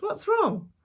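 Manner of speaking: high, loud startled question; surprised question. Acted. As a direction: caught off guard.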